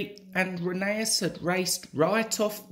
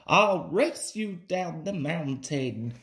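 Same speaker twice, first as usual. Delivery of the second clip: ordinary delivery, nothing marked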